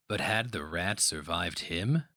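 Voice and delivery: ordinary delivery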